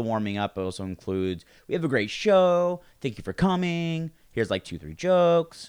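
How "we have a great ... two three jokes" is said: high, slow showman's opener; hammed-up host welcoming the crowd; mock stage announcer voice. Comedian's stage opener, mimicked.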